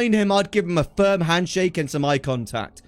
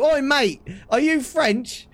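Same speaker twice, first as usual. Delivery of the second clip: raised, cheeky street hail; calling out; 5 semitones higher than usual. Pretend. To a random Frenchman walking along, pretend call-out. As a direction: hail a passing stranger, pitched up.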